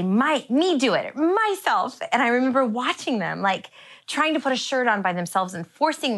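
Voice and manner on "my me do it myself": mock toddler insisting, loud and high. Mother imitating her toddler, loud and high. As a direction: play the stubborn little kid demanding to do it. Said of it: "They'd say".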